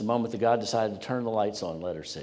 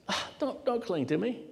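chuckle in his voice; 12 semitones higher than usual. Acted. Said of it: "may have had a chuckle in his voice".

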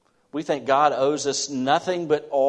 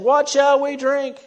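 loud, high, slow plea; complaining crowd; 10 dB louder, slower than usual. Acted. Israelites complaining to Moses, loud, high and slow. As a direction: voice the thirsty people crying out for water.

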